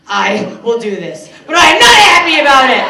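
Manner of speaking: shouted grudging complaint. Retold or imitated. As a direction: yell it like you hate doing it. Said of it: "They're just like".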